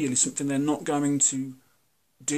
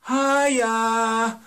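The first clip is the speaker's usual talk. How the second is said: squealed high, loud "Hiya"; mock an over-bright hello; fake cheery hiya; 8 semitones higher, 7 dB louder than usual. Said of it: "kind of thing".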